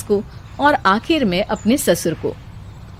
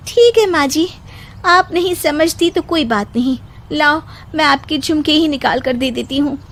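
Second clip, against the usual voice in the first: put-on higher young bride voice, patiently scolding; higher daughter-in-law warning of ridicule; daughter-in-law, reasoning; 5 semitones higher than usual. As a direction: warn her mother-in-law kindly.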